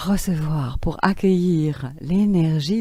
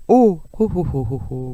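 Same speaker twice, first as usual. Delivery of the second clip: laughing from the top. Shown as a demonstration. Teacher demonstrating laughter placed in the head. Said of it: "Vous riez avec le haut".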